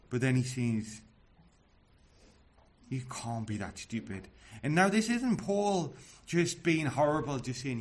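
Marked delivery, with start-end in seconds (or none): 2.9-4.3 low, quiet disbelief; acted exasperation, hushed and lowered; exasperated. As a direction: drop low and soft, exasperated. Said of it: "because he is exasperated".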